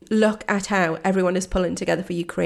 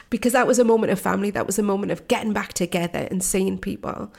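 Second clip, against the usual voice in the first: usual speaking voice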